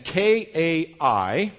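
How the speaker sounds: letters K A I one by one, slowly; slow spelling for the class; spelled out slowly. Shown as a demonstration. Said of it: "This first word is the word and".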